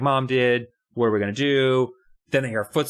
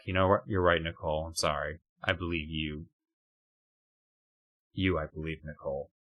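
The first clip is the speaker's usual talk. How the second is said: soft giving-in dad; 6 semitones lower, 7 dB quieter than usual. Retold or imitated.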